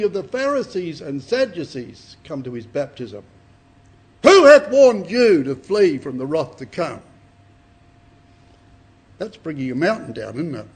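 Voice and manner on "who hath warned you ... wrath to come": loud, slow prophet's rebuke; booming rebuke. Acted. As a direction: thunder it at the Pharisees like John. Preacher voices John rebuking Pharisees.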